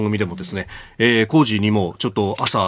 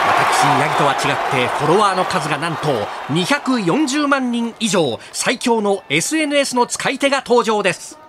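sports commentator; 9 semitones higher than usual. Acted. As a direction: hype play-by-play voice.

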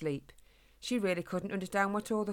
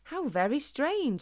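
higher, slow and drawn out; puzzled wonder; 8 semitones higher, 4 dB louder, slower than usual. A narrator's reading. Young Harris remarks to his dad on humans, wondering. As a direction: linger in wonder, pitched up.

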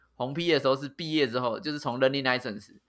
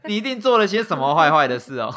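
laughing; 4 semitones higher, 9 dB louder than usual. Real, not acted. Teasing friend about unlicensed driving.

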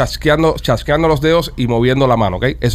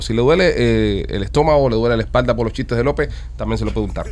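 announcer plugging a sponsor; ad read; 4 semitones lower, 3 dB quieter than usual. Acted. Host promotes the CBD sponsor, ad voice. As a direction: sell the product like a radio ad.

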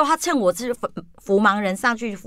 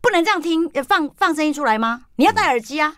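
retold scolding; 7 semitones higher than usual. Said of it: "你知道他马上就骂我".

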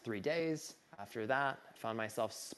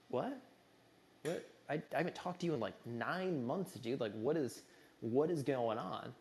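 stunned disbelief; 4 semitones higher than usual. Retold or imitated. Stunned reaction to urgent call.

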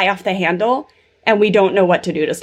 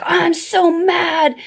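imitating angry toddler; 10 semitones higher, slower than usual. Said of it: "she was just like".

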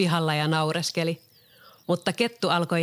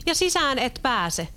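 much higher defiant cry; pigs shouting; 8 semitones higher than usual. A narrator's reading. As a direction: call out in defiance through the door. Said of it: "possut huusivat". (possujen korkea uhmakas huuto)

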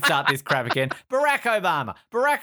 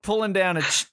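normal talking voice

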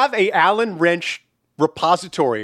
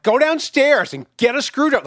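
higher-pitched nagging yell, held back; mock yelling mom; 7 semitones higher than usual. Son imitating mom yelling at dad about the table, mock yell. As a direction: imitate a mother yelling orders. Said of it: "like, yelling at him".

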